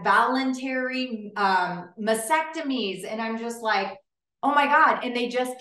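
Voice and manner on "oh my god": higher, louder burst of dismay; exasperated dismay. Retold or imitated. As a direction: throw up your hands in disbelief. Reacting to clients' many surgeries.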